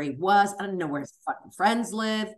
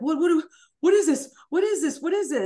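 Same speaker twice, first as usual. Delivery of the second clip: high, loud alarmed repeated question; panicked; 9 semitones higher, 5 dB louder than usual. Retold or imitated. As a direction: stammer it out in alarm. Ex panicking at the papers.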